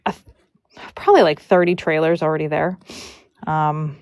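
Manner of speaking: usual speaking voice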